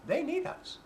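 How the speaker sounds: high, delighted cry of the military; gleeful military. Retold or imitated. Military crowing to society, a high delighted cry. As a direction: crow it like the military just got lucky. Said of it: "the military looks at society and says".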